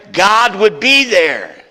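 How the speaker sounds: high, loud, slow "God would be there"; booming climax. Acted. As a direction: ring it out to the back row. Loud climax after quiet version.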